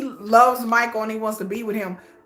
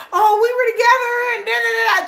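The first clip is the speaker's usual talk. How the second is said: high, louder mocking imitation of a smitten woman; mock their gushing in a raised voice; mock lovestruck; 9 semitones higher, 5 dB louder than usual. Mimicking women who call a fling a love affair, mock lovestruck.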